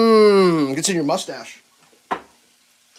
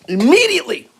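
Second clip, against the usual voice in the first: high, loud, insistent "Immediately!"; "Immediately!" belted out higher and louder, a hammed-up demand; mock urgent shout; 8 semitones higher, 7 dB louder than usual. Real, not acted. Demanding more coffee from himself, hammed-up shout. Repeats the word as an outburst.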